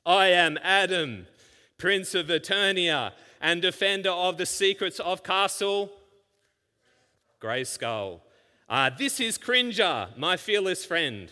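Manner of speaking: hammed-up cartoon hero voice; He-Man intro. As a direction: boom it like an eighties cartoon hero. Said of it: "I'll read you how it used to start".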